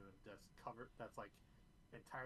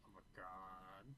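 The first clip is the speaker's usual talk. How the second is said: put-on valley girl; slower than usual. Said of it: "slightly sort of Valley Girl".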